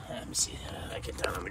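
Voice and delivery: whispered under his breath while getting down. Real, not acted. Getting down on the garage floor, whispering to himself. Crouching to look under car.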